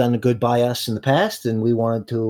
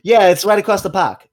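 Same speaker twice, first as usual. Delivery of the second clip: higher, louder hammed-up Boston accent; bad Boston accent; 8 semitones higher, 5 dB louder than usual. Junior explains where Boston is, exaggerated Boston accent. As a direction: lean hard into a fake Boston accent. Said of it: "My terrible Bostonian accent".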